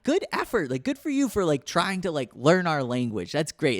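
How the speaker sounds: high, sing-song praise for a beginner; mock encouraging local. Latin Americans praising the foreigner's broken Spanish, high and chirpy. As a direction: pitch it way up like cheering on a kid.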